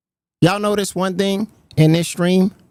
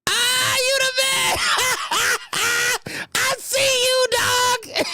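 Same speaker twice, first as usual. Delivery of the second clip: high, slow, crying-with-laughter praise; laughing admiration; 4 dB quieter, slower than usual. Retold or imitated. Host praising Tommy C's scheme, high laughing cry. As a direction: crack up while praising him. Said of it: "I was like".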